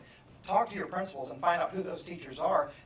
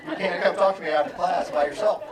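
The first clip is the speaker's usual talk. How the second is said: stated rule; 3 semitones higher, 9 dB louder than usual. Retold or imitated. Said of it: "set that one up for".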